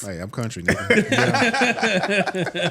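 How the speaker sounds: ordinary delivery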